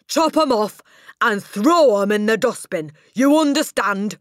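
high, loud roaring commands; furious shouting. Acted. Headmistress roars at the girl about her pigtails. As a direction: roar it right in her face.

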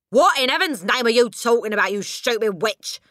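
loud, high outraged shout; Mister Wormwood shouting. A narrator's reading. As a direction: bellow insults at your wife. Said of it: "Mister Wormwood shouted".

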